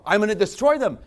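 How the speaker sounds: loud, high booming threat; loud divine threat; voice of God. Retold or imitated. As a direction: thunder it as God. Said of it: "Sodom and Gomorrah".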